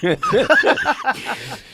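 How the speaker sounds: high, loud laughter; big laugh. Real, not acted. Guest laughs at the teasing of his impression, real high laugh. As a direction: burst out laughing.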